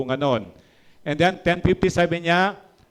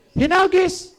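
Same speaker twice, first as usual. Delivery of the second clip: dramatic exclaim; 5 dB louder, slower than usual. Acted. Preacher's dramatic one-word climax.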